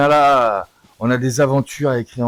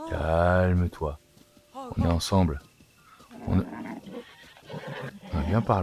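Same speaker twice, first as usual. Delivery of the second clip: soothing; 5 semitones lower, 6 dB quieter than usual. Acted. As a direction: gently settle the horse. Soothing horse after long struggle.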